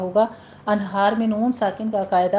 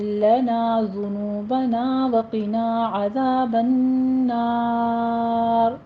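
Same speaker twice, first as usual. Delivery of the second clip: slow, melodic tajweed chant; Quran recitation; 2 semitones higher, much slower than usual. Acted. Teacher recites the verse to students, slow chant. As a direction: recite the prayer slowly, drawn out.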